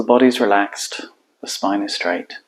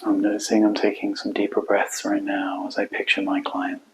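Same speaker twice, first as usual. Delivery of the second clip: quiet, spacious meditation voice; guided meditation; 5 dB quieter than usual. Acted. Coach guiding listeners to hold client and heart, quiet meditation. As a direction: let each phrase settle into a long silence.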